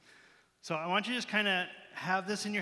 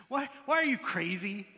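higher-pitched playful disbelief; mock exasperation; 4 semitones higher than usual. Reader asking Peter about his antics, mock exasperation. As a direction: question him as if baffled, joking.

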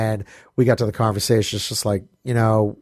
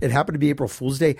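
ordinary delivery

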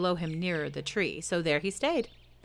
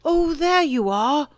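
loud, slow high call from the treetop; 9 semitones higher, 9 dB louder, slower than usual. A narrator's reading. Pooh in the tree calling down to the returning child. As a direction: call down, stretching it out. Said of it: "called down Winnie the Pooh".

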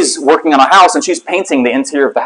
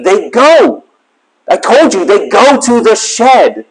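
higher voice, imitating a fed-up wife; exasperated insistence; 7 semitones higher, much slower than usual. Wife repeating instructions to husband, exasperated high voice. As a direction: hammer it home, high and drawn out at the end.

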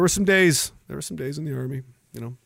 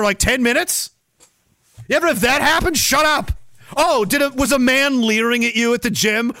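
ordinary delivery

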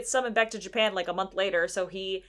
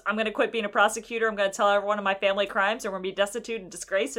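rushed confession; 2 semitones lower, much faster than usual. Retold or imitated. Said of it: "And he goes".

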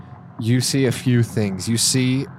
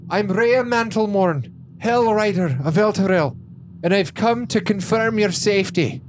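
formal announcement; 9 semitones higher than usual. Acted. Rhea declares herself to the Overseer, high and formal. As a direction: announce yourself with pomp.

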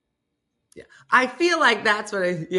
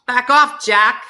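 loud, high, sharp back off; snappy comeback; 6 semitones higher, 10 dB louder, slower than usual. Acted. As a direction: bark it at him with a laugh.

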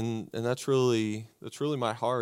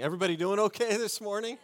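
higher, fast, rising crowd greeting; energetic greeting; 8 semitones higher, much faster than usual. Shown as a demonstration. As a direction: pump up the room, asking again. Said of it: "super convincing".

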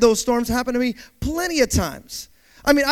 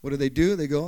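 ordinary talk, nothing marked

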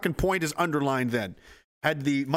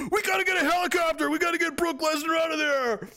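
much higher frantic calling; panicked shout; 13 semitones higher than usual. Retold or imitated. Soldiers calling for a helicopter, panicked shout.

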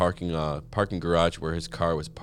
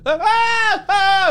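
very high, loud trapped scream; panicked scream; 12 dB louder than usual. Acted. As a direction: let the terror rip out. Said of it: "I wanna do the scream again".

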